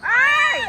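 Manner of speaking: sudden high, loud "ay"; excited yelp. Real, not acted. Parent reacting to the game from the sidelines, excited yelp. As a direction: yelp out at the play.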